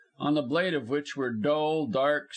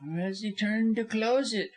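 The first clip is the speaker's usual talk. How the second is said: high-pitched, pointed comeback; high lawyer's quick riposte; smart retort; 9 semitones higher than usual. A narrator's reading. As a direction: cap his remark cleverly. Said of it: "retorted Busham smartly".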